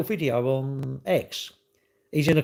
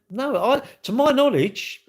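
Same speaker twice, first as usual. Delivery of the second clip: indignant rebuttal; 12 semitones higher, 4 dB louder than usual. Retold or imitated. Arguing back against blaming police.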